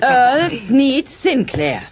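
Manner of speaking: high, loud, slow full-name reprimand; stern scolding. Acted. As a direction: call him out by his full name. Wife scolds husband by full name.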